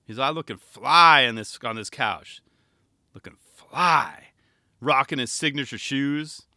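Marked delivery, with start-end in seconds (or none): 3.1-4.3 slow whisper of admiration; whispered aside. Acted. Host admiring Tyshawn on the couch, slow whisper. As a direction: breathe it out slowly, impressed.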